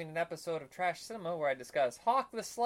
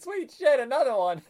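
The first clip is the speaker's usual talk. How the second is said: high loud gleeful whoop; loud mock glee; excited kid; 9 semitones higher, 8 dB louder than usual. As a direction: cheer like a kid hitting the next wall. Kid bashing walls in game.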